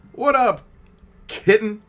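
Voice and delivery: high, loud flirty hello; flirty greeting. Acted. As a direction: tease a viewer with a cheeky hello. Greeting a viewer playfully.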